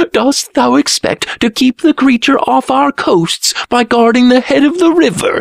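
very high mocking jeer; scoffing. A narrator's reading. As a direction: scoff at him in a high-pitched voice. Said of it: "he scoffed".

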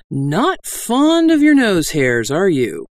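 ordinary delivery, nothing marked

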